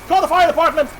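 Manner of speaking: high, loud shrieking alarm; hammed-up terrified shout; panicked scream. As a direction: scream for help at the top of your voice. Panics at people on fire.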